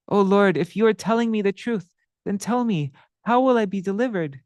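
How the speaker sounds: pleading sinner. Acted. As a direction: beg for mercy, voice lifted. Madhai pleads.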